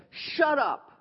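sharp retort. Retold or imitated. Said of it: "So I immediately said".

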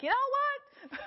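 high, laughing squeal of annoyance; laughing mock exasperation. Preacher laughingly imitating her old irritated self. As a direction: throw it up high through a laugh.

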